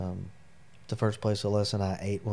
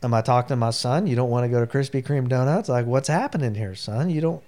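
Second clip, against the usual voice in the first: loud, raised-pitch mock disbelief; incredulous dad; 4 semitones higher, 6 dB louder than usual. Dad to son refusing Krispy Kreme, incredulous. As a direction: question him as if he can't be your son.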